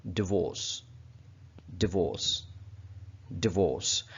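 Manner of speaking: डिवोर्स with a short di, slow and falling; correct pronunciation. Shown as a demonstration. Teacher modelling डिवोर्स for learners to repeat. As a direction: say डिवोर्स clearly, short di not dai. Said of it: "बल्कि ये क्या word है?".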